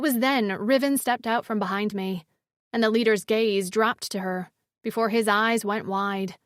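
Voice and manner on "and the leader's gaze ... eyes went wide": ordinary delivery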